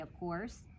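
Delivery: usual speaking voice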